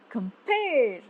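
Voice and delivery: "compare" with PARE long and swelling, then falling; light "com", heavy drawn-out PARE in "compare"; stressed second syllable. Shown as a demonstration. Teacher isolating one iamb, PARE stressed in "compare". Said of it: "unstressed followed by a stressed syllable".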